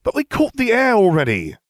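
schoolboy whining at the headmistress; whined. A narrator's reading. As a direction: whine like a spoiled boy. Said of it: "I whined".

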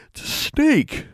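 words stretched through a yawn. A narrator's reading. Girl just woken answers her roommate, yawning. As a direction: say it mid-yawn, high and slow. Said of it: "she yawned".

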